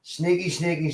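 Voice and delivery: usual speaking voice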